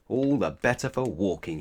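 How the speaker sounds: ordinary delivery, nothing marked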